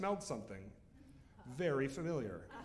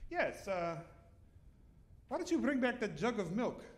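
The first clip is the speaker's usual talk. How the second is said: mother-in-law voice; 6 semitones higher than usual. Retold or imitated.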